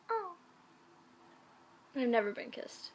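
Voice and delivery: normal talking voice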